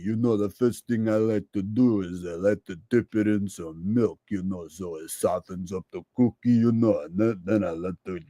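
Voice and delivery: low, mumbled Rocky voice; Stallone impression. Comedian answers a fan's request, low mumbling Rocky voice. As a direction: slur it like Rocky Balboa. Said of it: "Can we get a Sylvester Stallone impression?".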